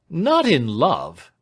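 loud, high, drawn-out astonishment; startled cry. A narrator's reading. Lawyer cutting in on client's confession, startled cry. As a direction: cry it out in disbelief. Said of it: "cried Snitchey".

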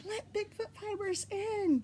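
high, small pleading voice; playful plea. Pretend. Podcaster coaching organizers to let her in, playful high plea.

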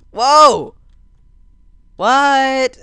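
loud high whoa, what; astonished shout. Real, not acted. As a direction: blurt out your shock. Surprised by sudden teleport.

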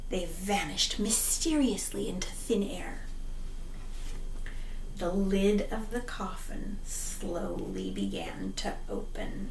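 low whispered suspense narration; spooky whisper. A narrator's reading. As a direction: hush it like a ghost story. Spooky storytelling.

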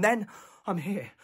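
usual speaking voice

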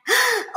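high delighted gasp; a thrilled parent's gasp. Retold or imitated. Parent hearing baby's first word, high thrilled gasp.

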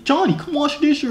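very high mock parent holler; imitation of a nagging parent's call; parent calling a child. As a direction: send the kid to chores in a put-on high voice. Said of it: "when your parents calling you".

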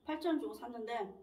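chewing between words; talking around food; mouth partly full. Real, not acted. Seen 3: Lips pressed, chewing-like between words early; later just talking, no food.

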